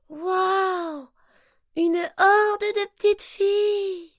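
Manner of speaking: high, slow, wide-eyed wonder; amazed wow. Acted. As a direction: marvel at what you see. Pony amazed at the river.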